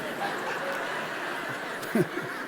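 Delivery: breathless unvoiced laugh; a shaky air-only laugh; nervous laugh. Reaction to lightning hit.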